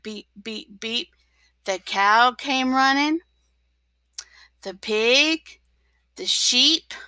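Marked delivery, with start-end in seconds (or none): 0.0-1.1 beep, beep said much softer, trailing off; narrator's hushed beep, beep. A narrator's reading. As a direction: tuck the beeps away quietly. Narrator retells the beeps.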